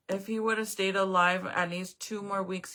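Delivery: usual speaking voice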